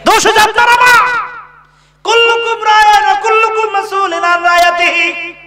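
high, insistent sermon cry; preacher's high-pitched repeated refrain, easing down at the end; forceful preaching. Real, not acted.

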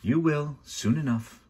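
high, lilting menace; sly threat. A narrator's reading. Villain pushing children stageward, slyly threatening.

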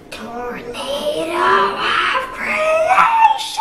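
high, slow, drawn-out battle cry; loud yell. Acted. Jay yelling the spell, high and stretched out. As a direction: shout it as loud as you can. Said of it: "Jay yelled as loud as he could".

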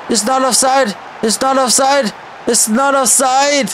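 high, repeated insistent protest. Real, not acted. Gamer protests the offside call, insistent. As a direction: keep insisting it's not offside.

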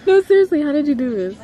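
high, loud, laughing amazement; overjoyed disbelief. Real, not acted. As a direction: gush with speechless joy. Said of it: "I'm so speechless".

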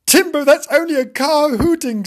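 high, loud retort; exasperated dog. Acted. Dog telling kitten it's only a car, exasperated. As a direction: call out over the kitten's panic.

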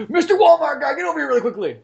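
high, loud hail across the store; calling out. Acted. Customer calls clerk over to spite kid, showy calling. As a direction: summon someone urgently and showily.

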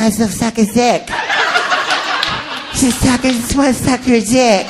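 a lightly voiced creepy whisper, slow; a hint of a creepy English man. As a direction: drawl it out creepily. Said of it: "creepy fucking voice".

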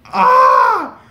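high, loud squealed scream; overwhelmed fangirl scream; excited scream. Retold or imitated. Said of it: "I was screaming".

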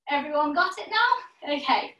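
normal talking voice

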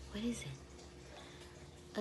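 slow hushed question; whisper. Acted. Teacher quizzing children, slow whisper. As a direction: drag out a soft whisper.

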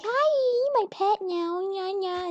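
put-on high sweet baby voice; cute squeaky spider. Gamer voices the cute daytime spider in a high coo. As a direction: coo at it like a tiny pet.

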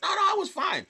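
high, sing-song fake reassurance; mock polite friend. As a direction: play a fake-nice friend lying to be kind. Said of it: "people who aren't your friends will be like".

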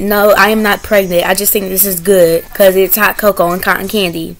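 usual speaking voice

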